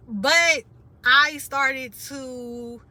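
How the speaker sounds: ordinary delivery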